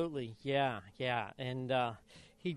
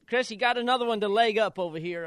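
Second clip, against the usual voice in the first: calling out; 6 semitones higher, 6 dB louder, faster than usual. Retold or imitated. Calling out to Chris.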